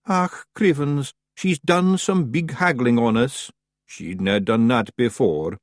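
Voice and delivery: a worried little Scots fairy; small Scottish Feagle muttering. Acted.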